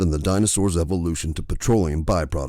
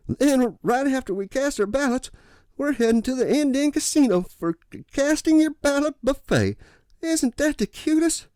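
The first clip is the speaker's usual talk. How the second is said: put-on sweet old lady. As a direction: gush like a delighted grandmother. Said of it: "An elderly woman approached me".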